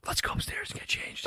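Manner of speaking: continued conspiratorial whisper. Shown as a demonstration. Dad whispering the kids upstairs to change. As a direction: keep whispering the plan.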